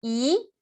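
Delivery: Y gliding upward; second tone. Shown as a demonstration. Teacher showing second tone, Y rising. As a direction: lift "Y" steadily like a question. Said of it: "segundo tono, para arriba".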